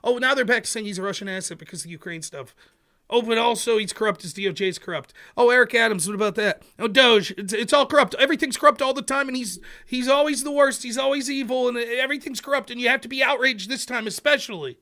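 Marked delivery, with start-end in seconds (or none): 3.1-14.8 loud, higher sarcastic ranting; hammed-up outrage, piling it on; sarcastic rant that keeps building, loud and high; mock outrage. Sarcastic rant mocking constant outrage.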